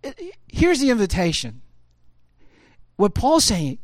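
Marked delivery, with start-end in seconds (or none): none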